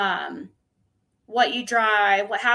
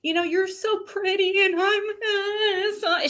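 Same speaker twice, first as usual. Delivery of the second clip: high, whimpering mock sob; mock crying sister; 10 semitones higher than usual. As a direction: imitate the sister crying about her looks, high-pitched. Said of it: "She would cry to me like".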